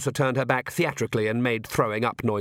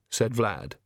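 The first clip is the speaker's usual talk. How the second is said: ordinary delivery, nothing marked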